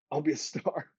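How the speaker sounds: cocky claim breaking into laughter; laughing boast. Retold or imitated. Son promising his dad stardom, laughing. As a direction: brag it while laughing, pitch climbing.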